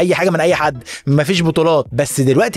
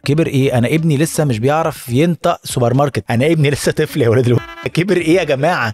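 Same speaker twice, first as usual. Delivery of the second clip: ordinary talk, nothing marked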